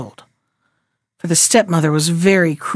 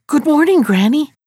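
put-on higher, polite child's greeting; civil, terrified children; 6 semitones higher than usual. A narrator's reading. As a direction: greet politely with a touch of nerves. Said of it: "they answered civilly, though they were so terrified".

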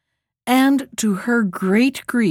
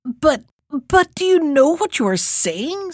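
put-on flustered, higher old woman's stammer; stammering old mother; 5 semitones higher than usual. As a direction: stumble over the words, flustered. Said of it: "stammered the mother".

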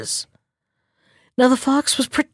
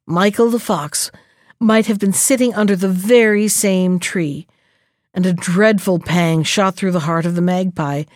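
usual speaking voice

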